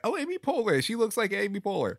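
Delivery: high-pitched mock surprise; mock realization. Telling cohost about mistaking [name], high mock surprise. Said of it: "I was just like".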